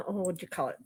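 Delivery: ordinary delivery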